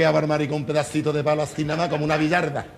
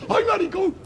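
high camp squeal. Acted. Camp man tells his friend the news, high squeal. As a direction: shriek it out in a falsetto. Camp character's excited cry.

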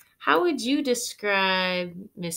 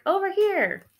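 higher, louder call; 12 semitones higher, 6 dB louder than usual. Acted. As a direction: call her over. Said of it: "Holly's like".

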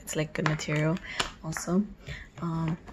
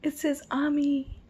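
high-pitched delight, swelling louder; excited gushing; 11 semitones higher, 3 dB louder than usual. Real, not acted. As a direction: gush over it, voice rising high. Fan gushing over the merchandise.

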